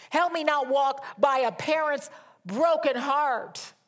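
loud, pleading preacher's cry; impassioned preaching. Acted. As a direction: plead each line with full force, hammering help. Preaching the father's plea.

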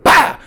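loud, high burst to cap a move; sudden high shouted impact noise; martial arts yell. Retold or imitated. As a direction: punch out an explosive "bah". Imitating the final backflip move.

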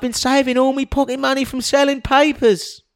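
high, eager street urchin; poor little boy voice. Acted. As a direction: plead like a grubby paper boy. Boy character pleading in sketch.

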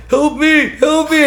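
high, loud mock plea; imitated cry for help. Surfer imitates another drowning man calling her, mock cry for help. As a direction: mimic a drowning stranger calling out. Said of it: "I hear another voice going".

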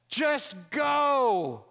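desperate shout, loud and high; yell. Acted. Drowning surfer yelling to Brad, loud and high. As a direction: shout it to save him. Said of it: "yells to Brad".

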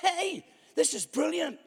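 high, thrilled approval; mock-excited high voice; delighted inner thought. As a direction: cheer it on like a fan. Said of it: "I thought".

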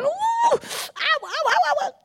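high, hammed-up yelps of pain; mock crying ows. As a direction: squeal each ow high like a hurt child. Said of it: "So I'm crying, I'm going".

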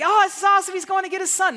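high, gushing excitement; mock admiring onlookers. Onlookers praise the dad climbing up, mock gushing excitement. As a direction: imitate a thrilled crowd cheering on a dad. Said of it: "people are like".

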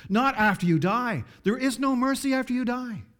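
high, loud, hammer the NOT and NO; fiery preaching. Acted. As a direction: drive the warning home.

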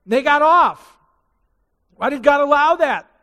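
high, loud protest at God; preacher's peak of outrage; outraged cry. Acted. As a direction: cry out against the unfairness. Climax of injustice point.

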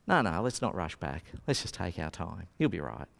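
no rush, all is fine; relaxed unhurried Jesus. Retold or imitated. Jesus calmly telling the sisters not to rush.

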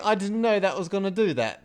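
high, loud, laughing imitation; mock surprise. Mimicking the checkout chick at Coles, mock surprised. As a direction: squeal it in amused disbelief.

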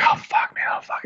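retold panic in a whisper; whispered dread. Customer in the barber chair dreading the cut, whispered dread. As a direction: mutter it under your breath, voiceless and sinking.

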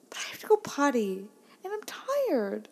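mimicked kid's excuse, climbing high; whining daughter. Daughter hunting for an excuse from Mom, whining. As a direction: stretch "tired" into a high whine. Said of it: "clearly struggling to find some kind of excuse".

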